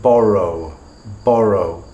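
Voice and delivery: "borrow" with a smooth R between the vowels; "borrow" said as an isolated example, approximant R; mid-word English R demo. Shown as a demonstration. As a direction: model "borrow" carefully, R untapped. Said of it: "in the middle of a word".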